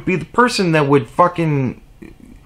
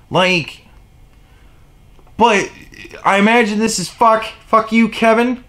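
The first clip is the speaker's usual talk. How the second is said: ordinary talk, nothing marked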